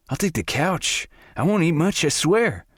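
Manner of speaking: high, eager, over-the-top begging; eager pleading. A narrator's reading. Sawyer begging to move in.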